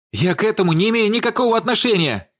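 high-pitched, panicky denial; hysterical cry. A narrator's reading. Владимир denies any part in the killing, hysterical cry. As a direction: protest in rising panic. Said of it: "выкрикнул он истерично".

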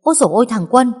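put-on loud, high startled cry of an old mother; alarmed cry. Mother-in-law finds son-in-law soaked at the gate, crying out loud. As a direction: yelp out in sudden alarm. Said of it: "bà hốt hoảng mà rú ầm lên".

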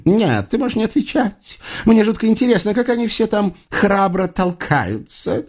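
high-pitched mocking witch; witch's lofty sarcastic curiosity; puzzled witch. A narrator's reading. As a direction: needle him sweetly in a high voice. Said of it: "недоумённо поджала губки ведьма".

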